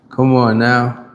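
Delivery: usual speaking voice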